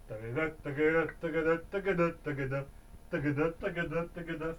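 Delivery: galop imité. Acted. Bruit du cheval au galop.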